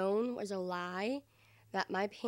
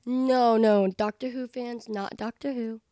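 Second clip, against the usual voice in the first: ordinary talk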